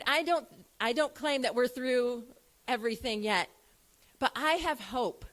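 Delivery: usual speaking voice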